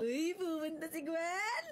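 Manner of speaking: slow, teary laughing words; laugh-crying. Real, not acted. Gwen retelling her surprise, laughing through tears.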